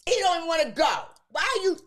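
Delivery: high, loud protective outburst; imitating her younger self defending her brother; retold protest. Defending her crying brother to her mother, loud and high. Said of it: "I said".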